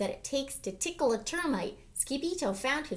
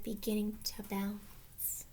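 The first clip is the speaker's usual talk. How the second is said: muttered; 5 semitones lower, 10 dB quieter, slower than usual. A narrator's reading. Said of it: "he muttered".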